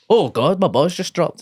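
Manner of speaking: gasping mock realisation; mock surprise. Acted boy noticing his balls.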